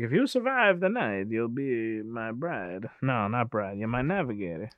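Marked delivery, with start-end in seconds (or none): 0.0-2.9 slow, drawn-out villain's proposal, trailing softer; Dracula. Retold or imitated. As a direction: play a melodramatic vampire, stretching out the last phrase. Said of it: "He's Dracula now".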